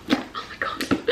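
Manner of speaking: breathless whispered disbelief; awed whisper. Real, not acted. Seeing the next package, a stunned whisper.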